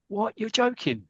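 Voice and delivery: put-on young player can't believe the news; surprised disbelief. Young player told he is picked.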